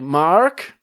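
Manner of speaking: put-on girlish Californian "Mark?" with rising tune; Californian accent. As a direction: try out a questioning Californian accent. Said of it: "like, California?".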